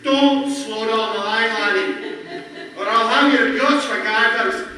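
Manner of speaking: loud, high scolding in a Scottish brogue; stern Scottish accent. Retold or imitated. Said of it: "Without the Scottish accent, actually".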